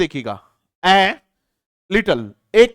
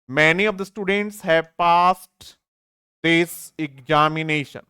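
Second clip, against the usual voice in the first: slow reading; much slower than usual. Shown as a demonstration. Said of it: "past है बोलेंगे".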